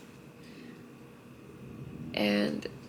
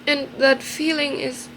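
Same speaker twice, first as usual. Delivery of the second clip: choked-up rise as the tears come; crying; 7 semitones higher than usual. Real, not acted. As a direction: push the words out while crying. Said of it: "Shit, I look so stupid".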